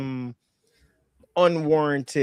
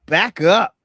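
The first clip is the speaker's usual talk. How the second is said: loud, raised instruction; emphatic coaching order; 5 semitones higher, 9 dB louder than usual. Pretend. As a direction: call it out to the quarterback. Coaching the absent quarterback directly.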